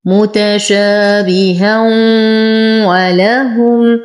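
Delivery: very slow "متشابها ولهم", tanween melting into a long nasal w; إدغام بغنة. Shown as a demonstration. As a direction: draw out "متشابها ولهم" with a hum merging into the waw. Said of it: "فالحكم إدغام بغنة". ("متشابها ولهم" ببطء مع إدغام التنوين بغنة)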